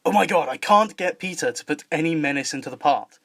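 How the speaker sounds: English accent in a normal pitch; English voice with crisp T's and no R's. Shown as a demonstration. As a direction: deliver it in a southern English accent. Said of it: "in contrast to the English accent".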